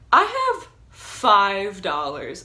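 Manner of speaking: slow, loud, defiant; furious. Retold or imitated. To the robber, slow loud defiant refusal. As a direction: spit each word at him slowly. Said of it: "I was just like".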